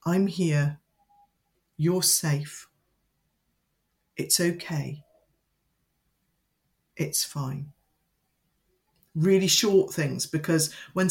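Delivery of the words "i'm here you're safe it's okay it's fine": soothing reassurance. Shown as a demonstration. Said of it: "but to just say".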